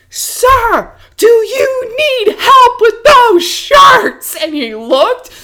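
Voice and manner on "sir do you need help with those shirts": high, loud, drawn-out yell; screaming across the store. Retold or imitated. Clerk yelling from the register at a thief, screaming. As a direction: belt it across the room, slowly. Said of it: "screamed it into the men's section".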